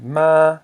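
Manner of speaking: ma held high and level. Shown as a demonstration. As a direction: say ma loud with a high tone. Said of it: "this equals a high tone".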